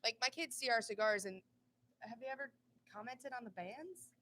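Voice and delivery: ordinary talk, nothing marked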